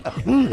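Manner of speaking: high mock muffled moaning; muffled moans. The woman with a full mouth, high muffled moaning. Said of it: "She's like".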